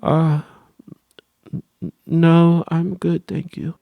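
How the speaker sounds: put-on high, halting little-boy refusal; lightly voiced nervous kid; timid young self. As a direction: stammer out a polite no as a scared kid. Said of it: "back then I was like".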